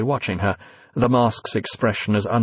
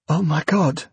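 gasped; 6 semitones higher than usual. A narrator's reading. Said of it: "gasped Evie".

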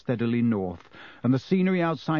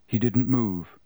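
plain reading voice

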